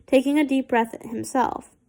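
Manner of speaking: ordinary delivery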